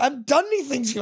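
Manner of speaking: high-pitched retold protest; indignant protest. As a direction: plead innocence to a bully, pitched right up.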